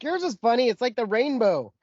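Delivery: high-pitched comeback; exaggerated high retort; mock retort. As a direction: imitate yourself teasing back, voice way up. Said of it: "I'm like".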